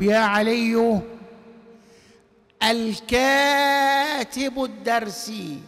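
يا علي الكاتب الدرسي drawn out; slow teaching voice; slow recitation. Shown as a demonstration. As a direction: lay the example out word by word. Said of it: "teacher recites example slowly".

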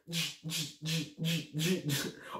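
repeated short buzzing fizz sounds; imitated skidding firework. Storyteller mimics the firework on the floor, imitated fizzing. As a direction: mimic a firework jerking along the ground.

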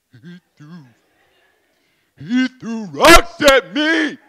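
sobbing out the words, slow, breaking into wails; imitated crying. Little brother sobs to his big brother, crying loudly. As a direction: wail it like a kid in tears.

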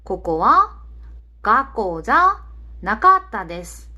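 slow, word-by-word Japanese example; careful teacher's demonstration, drawn out; slow model sentence. Shown as a demonstration. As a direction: model it slowly for learners, phrase by phrase.

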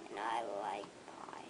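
joking whispered outro. Acted. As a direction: whisper the request. Whispered joke.

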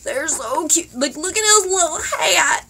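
high, gushing coo over something cute; gushing squeal. Real, not acted. Gushing over a cute plush toy.

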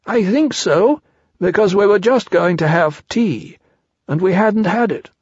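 high, artless character voice; talking chair, reasoning. Acted.

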